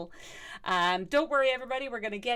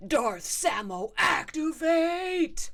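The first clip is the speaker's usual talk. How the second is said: drawn-out mock Darth call; mock villain activation; 4 semitones higher, 5 dB louder, much slower than usual. Streamer switching on Darth Samo for fun, mock villain call. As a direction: stretch it out, rising louder on activate.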